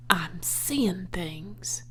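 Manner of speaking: slow hushed whisper. Acted. Lurvy stunned at the web, whispering slowly. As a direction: breathe it out, stunned. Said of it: "he whispered".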